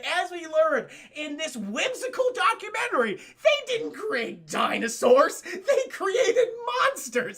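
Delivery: loud, high, drawn-out proclamation; mock outraged shouting. Streamer ranting to chat about fake dinosaurs, high mock outrage. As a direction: shout it slowly with hammed-up indignation.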